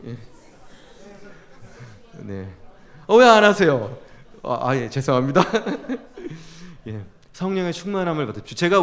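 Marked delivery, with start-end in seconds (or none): 3.1-4.0 raised, louder prod to the audience; loud, higher-pitched nudge; calling out. Real, not acted. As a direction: call out to a crowd that isn't responding. Prods audience that is not responding.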